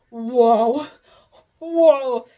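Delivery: loud, drawn-out mock whoa; a hammed-up loud whoa; aroused whoa. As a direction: belt out an overwhelmed whoa. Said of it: "'cause it's like".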